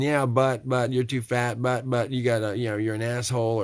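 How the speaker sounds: mock inner critic. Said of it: "without saying".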